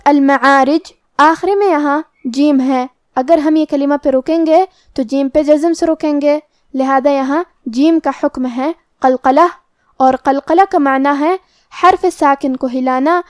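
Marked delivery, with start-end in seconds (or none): none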